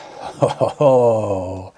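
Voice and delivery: drawn-out, delighted "ho-ho-ho"; real pleased chuckle; delighted laugh. Real, not acted. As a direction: laugh with delight at a lucky find. Delighted at finding rhubarb pie.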